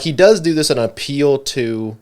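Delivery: ordinary talk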